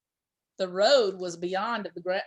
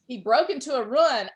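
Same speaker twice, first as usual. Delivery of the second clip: plain reading voice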